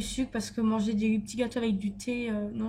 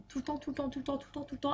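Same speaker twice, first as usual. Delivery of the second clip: much softer, rattled off under the breath; muttered repetition; 9 dB quieter, faster than usual. Real, not acted. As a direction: mumble it on and on, fed up. Rants about fries all the time.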